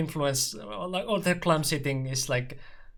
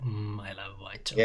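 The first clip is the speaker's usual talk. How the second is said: low, softer mock relish; savouring chocolate; 6 semitones lower, 5 dB quieter, faster than usual. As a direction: savour it playfully, pitched down.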